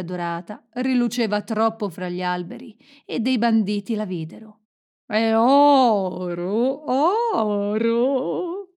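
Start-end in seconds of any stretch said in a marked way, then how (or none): 5.1-8.7 long drawn-out greedy cry; loud bandit holler, very slow; bandits' cry. A narrator's reading. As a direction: call it out, stretching every vowel.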